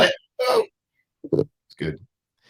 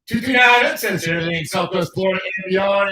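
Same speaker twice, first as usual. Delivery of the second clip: radio announcer; 8 semitones higher than usual. Acted. Radio show sign-off tagline.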